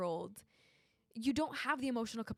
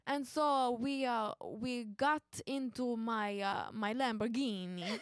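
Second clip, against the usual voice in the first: put-on playful Italian accent; slower than usual. Game player switching accents mid-story on a shout-out, Italian accent. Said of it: "Italian accent".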